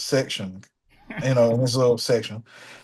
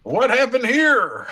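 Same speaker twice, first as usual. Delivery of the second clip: high, slow Kid impression; host asks to hear it, high and slow; Kid's voice on request; 9 semitones higher, slower than usual. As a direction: redo it high and drawn out for the listener. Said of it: "How did he say it?".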